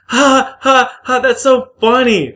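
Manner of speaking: high, loud mimicked cackle; hammed-up "ha ha" mockery; mocking fake laughter. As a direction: imitate people laughing at your accent, shrill and loud. Mocking the people who laughed.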